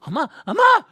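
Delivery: put-on high, loud child's call for mama; shouting for his mom. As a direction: call out across the store like a lost kid. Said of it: "I was like".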